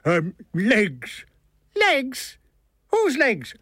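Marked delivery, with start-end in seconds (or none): 1.8-3.6 high, baffled Irish character voice; indignant questioning. A narrator's reading. Milligan challenges the author about his legs, indignant and high. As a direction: fire back high, falling questions.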